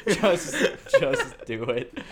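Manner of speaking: pleading through laughter; laughing. Real, not acted. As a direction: beg while cracking up. Laughing plea to be killed.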